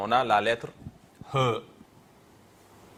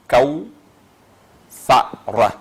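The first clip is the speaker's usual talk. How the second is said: kaou, a pause, then louder fa, ra; syllable by syllable; 3 semitones higher, 6 dB louder, much slower than usual. Shown as a demonstration. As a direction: sound out kaou, fa, ra one syllable at a time. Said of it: "on a trois syllabes".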